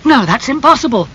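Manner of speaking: high, alarmed denial; acted outburst of horrified refusal; shocked cry. A narrator's reading. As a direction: cry out in disbelief, pitch leaping up. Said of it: "cried Dorian".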